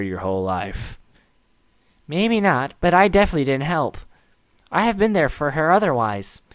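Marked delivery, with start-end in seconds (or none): 2.1-6.4 put-on a lightly higher, guilt-ridden young man; guilty friend voice. Menji, blaming himself for Sayori, in a lightly raised guilty voice.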